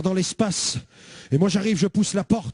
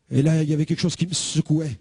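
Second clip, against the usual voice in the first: ordinary delivery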